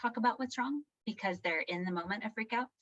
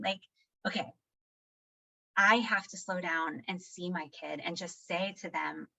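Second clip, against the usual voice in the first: ordinary talk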